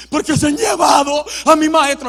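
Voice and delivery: high, loud acted wail; distraught woman. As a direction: cry out as a grieving woman in a drama. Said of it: "haciendo papel de mujer". (mujer desconsolada, lamento agudo y fuerte)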